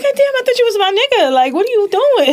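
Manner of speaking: high, loud, fast mock outrage; hammed-up shocked yell; mock outraged. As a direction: squeal it like a betrayed friend. Retold reaction to groping.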